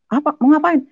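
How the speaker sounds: high, loud imitated challenge; father demanding. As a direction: voice the father barking what's up. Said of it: "Bapak saya datangnya".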